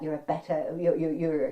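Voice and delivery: ordinary delivery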